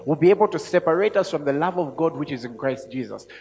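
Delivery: preaching scripture. Acted. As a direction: build the list toward the climax. Reads scripture with force.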